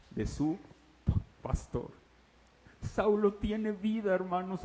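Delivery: ordinary talk, nothing marked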